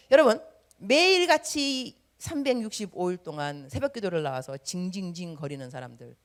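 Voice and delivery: ordinary talk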